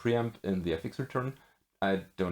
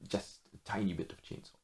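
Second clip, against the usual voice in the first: quiet; 8 dB quieter, faster than usual. Real, not acted. Softer restatement, tiny stressed.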